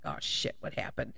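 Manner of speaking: hushed nervous whisper; breathy startled whisper under the breath. Retold or imitated. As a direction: whisper it so dad won't blow up. Kid reacting to furious dad.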